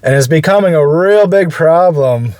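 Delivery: muffled. Real, not acted. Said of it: "Sorry for the muffled".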